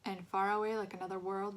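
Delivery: usual speaking voice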